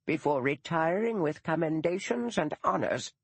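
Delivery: put-on a stiff elderly schoolmistress, proud and put out; dour old retired teacher. Old teacher Missus Gaddis voiced.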